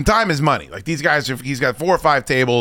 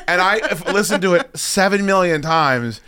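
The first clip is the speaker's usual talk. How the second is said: normal talking voice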